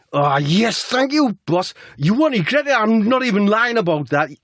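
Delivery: very high, gushing gratitude; hammed-up high-pitched delight; Jack. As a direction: squeal your thanks in a put-on voice. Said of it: "Jack says".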